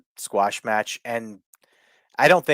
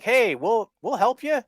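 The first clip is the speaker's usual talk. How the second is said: helpful voice; 12 semitones higher than usual. Retold or imitated. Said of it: "they were like".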